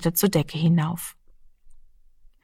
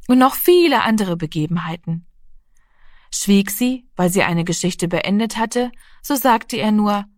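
usual speaking voice

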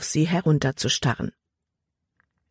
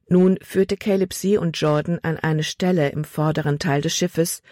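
usual speaking voice

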